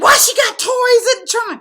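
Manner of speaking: high, loud imitated outcry; mock disbelief. Man spotting toys in the trunk, loudly mock baffled.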